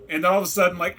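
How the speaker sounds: normal talking voice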